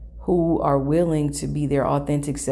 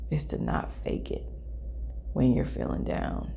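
soft, sinking almost to a whisper; hushed reflection; 11 semitones lower, 10 dB quieter than usual. Real, not acted. Speaker admitting how much this asks of oneself, hushed. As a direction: let the voice drop low and quiet. Sober reflection on not faking it.

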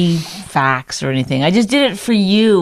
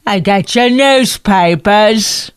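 slow, drawn-out mock street cry; newspaper seller; 3 semitones higher, 3 dB louder, slower than usual. Co-host shows partner how papers were sold, mock newspaper seller. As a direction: hawk papers with long stretched words. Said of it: "Is that how they did it?".

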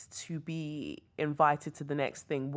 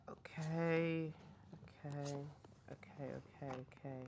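whispered "okay" muttered to herself; softly reacting to the cards; fading whispered okays; quiet; 11 dB quieter than usual. Real, not acted.

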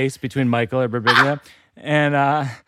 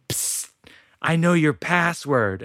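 hushed psst, then slow and deliberate; conspiratorial intern; 3 semitones higher, slower than usual. Acted. Old laptop secretly offering owner the password, conspiratorial. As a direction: let him in on a secret. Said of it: "my intern is like".

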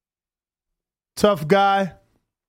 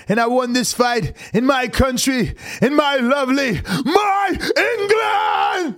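mock-rousing patriotic victory speech, climbing high; Mel Gibson; 4 semitones higher than usual. Streamer mimicking the boxer's victory speech, hammed-up rousing oratory. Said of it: "why he sound like Mel Gibson".

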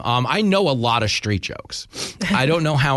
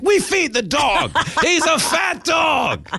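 high, slow, shouted protest; exasperated shout; much slower than usual. Retold or imitated. Parents protesting to the woman in the shop, high slow shout. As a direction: yell it out, fed up and defensive. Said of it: "We're like".

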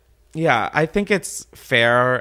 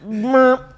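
high little cartoon peep; comic sinking noise; 12 semitones higher, 4 dB louder than usual. Comparing a haircut to the Titanic, comic squeak. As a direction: squeak it out as a helpless blip. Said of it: "and it's like".

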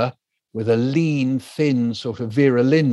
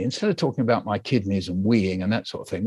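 ordinary delivery

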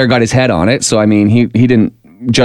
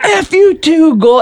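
angry retort; slower than usual. Retold or imitated. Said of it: "the guy who fell was like".

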